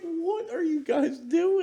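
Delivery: high, slow, laughing question; laughing disbelief. Retold or imitated. Retold reaction, laughing.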